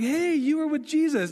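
put-on a light, high girlish accusation then a quick denial; lightly voiced servant girl at the fire; servant girl accusing. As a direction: play the girl pointing him out, then Peter brushing it off. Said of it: "this little servant girl comes up and like".

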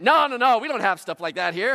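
high, loud careless mechanic voice; mock-cheerful mechanic, loud and high; breezy mechanic. Jiffy Lube worker refusing.

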